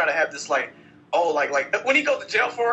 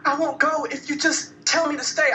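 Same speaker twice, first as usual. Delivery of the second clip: mocking girlfriend; 10 semitones higher than usual. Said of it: "she was like".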